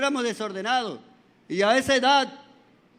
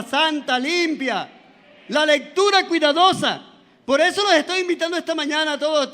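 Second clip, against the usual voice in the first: fervent preaching; 4 semitones higher than usual. Acted. Pastor urging the congregation to read the Bible carefully, fervent. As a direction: exhort the congregation with fire.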